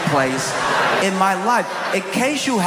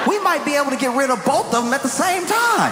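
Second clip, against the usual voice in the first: put-on excited kids arguing; 9 semitones higher than usual. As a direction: hype up your bet like a kid. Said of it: "And we're like".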